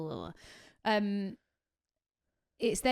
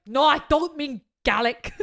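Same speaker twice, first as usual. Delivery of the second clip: louder, slower Scottish voice, Gaelic as GAL-ick; mock Scottish correction; 5 semitones higher, 10 dB louder, slower than usual. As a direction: correct her indignantly like a Scot. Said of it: "And he was like".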